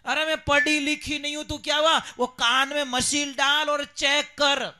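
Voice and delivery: put-on loud, indignant old mother; angry scolding. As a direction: shout at the doctor to check properly.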